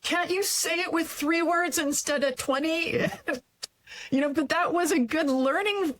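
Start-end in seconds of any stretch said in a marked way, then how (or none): 0.0-3.1 high, impatient mock boss voice; nagging her to cut it short; frustrated mentor. Frustrated mentor telling her to shorten, impatient. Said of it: "he was really frustrated with me".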